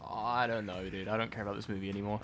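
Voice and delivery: quiet, fast fed-up aside; fed-up mumble. Real, not acted. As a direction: mumble it off like you've given up. Fed-up aside about the movie.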